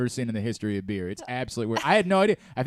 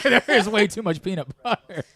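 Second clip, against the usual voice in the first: ordinary talk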